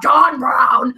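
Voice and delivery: loud, drawn-out dramatic proclamation; John Brown. Shown as a demonstration. Contrasting two names for co-host, loud documentary-style proclamation. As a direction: belt out the name like a documentary chorus. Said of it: "I'll do it in the John Brown voice".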